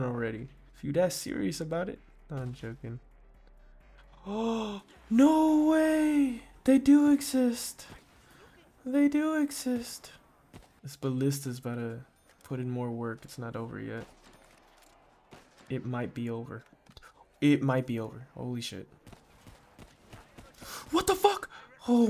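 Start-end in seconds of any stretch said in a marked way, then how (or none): 4.3-10.1 loud, high, slow cry of disbelief; can't believe his eyes; shocked. Real, not acted. Gamer spotting the max ammo he swore didn't exist, shocked shout. 20.9-22.1 very high, loud startled yelp. Real, not acted. Gamer startled by a zombie, high yelp. Sudden scare in game.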